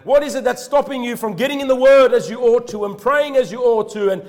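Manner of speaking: high and loud, pressing question; full-force preaching challenge. Acted. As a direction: drive the challenge home.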